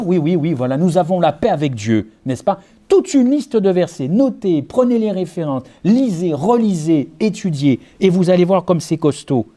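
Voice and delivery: ordinary delivery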